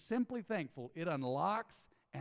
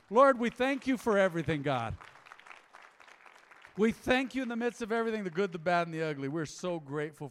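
loud, higher, fervent prayer; loud pulpit prayer; 3 semitones higher, 9 dB louder than usual. Real, not acted. Pastor praying aloud for his church, fervent and loud. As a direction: pray it out over the whole room.

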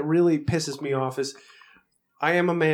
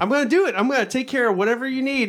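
high, louder eager promise; mock eager; 9 semitones higher, 3 dB louder, faster than usual. IT tech promising help, mock eager. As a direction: promise everything brightly.

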